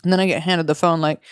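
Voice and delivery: ordinary talk, nothing marked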